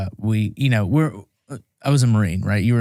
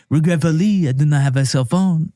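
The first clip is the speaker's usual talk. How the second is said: higher-pitched mock French accent; put-on French accent, formal apology; French reporter; 5 semitones higher than usual. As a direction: imitate a regretful French reporter declining politely. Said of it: "He's like".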